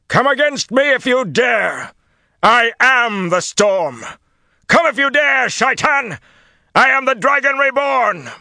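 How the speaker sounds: full-force high-pitched battle scream; defiant scream. A narrator's reading. Rand challenging his enemies amid the lightning, screaming. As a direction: hurl the challenge at the top of your voice. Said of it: "he screamed at them".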